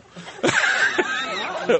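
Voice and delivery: very high shriek of laughter; real helpless laughter. Real, not acted. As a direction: let a high laugh burst out. Laughter peaks.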